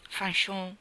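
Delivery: a hushed, tender call of her name. Acted. Landry pleads before she leaves.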